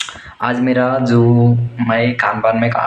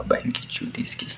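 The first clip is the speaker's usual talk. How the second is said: a muttered swear under the breath, much softer; whispered curse; 13 dB quieter than usual. Real, not acted. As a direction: hiss the insult to yourself. Muttered curse aside about boss.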